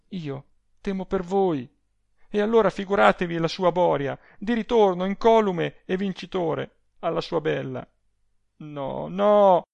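high, worried voice; anxious protest. A narrator's reading. Adele fears for Rossani in the duel, anxious.

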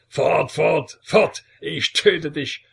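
high, loud furious scream; screaming in rage and terror. A narrator's reading. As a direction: shriek him away in disgust and fury. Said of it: "schrie er voll Wut und Schrecken".

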